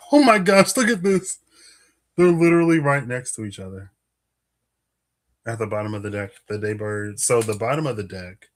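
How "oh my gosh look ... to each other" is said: high, loud burst of excitement; amazed discovery. Real, not acted. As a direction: blurt it out, thrilled at what just turned up. Finds the two cards adjacent.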